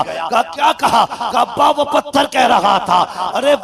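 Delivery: high, loud building preach; impassioned preaching. Acted. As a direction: build to a soaring climax. Building sermon climax. (जोश से भरा बयान, ऊँची आवाज़ में)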